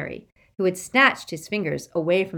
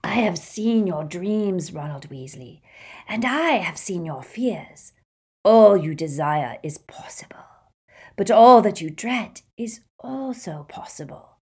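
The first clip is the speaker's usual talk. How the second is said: sinister whisper. Acted. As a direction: tempt him in a hiss. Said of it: "a voice hissed".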